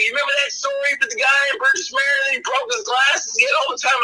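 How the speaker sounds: acted-out eager recollection; excited reminiscing. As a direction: gush about a favorite episode. Acting excited passenger reminiscing.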